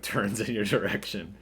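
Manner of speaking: usual speaking voice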